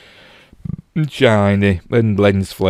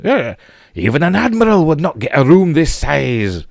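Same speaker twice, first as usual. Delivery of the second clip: hammed-up, higher-pitched Scottish accent; bad Scottish accent; 8 semitones higher than usual. Reviewer quoting Scotty on cramped rooms, bad Scottish accent. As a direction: put on a poor imitation of a Scottish accent. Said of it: "my bad, uh, Scottish accent".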